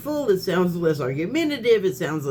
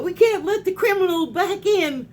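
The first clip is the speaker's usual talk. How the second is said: mocking Trump; 12 semitones higher than usual. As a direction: sneer it like a pompous president. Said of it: "the president criminal all talking about".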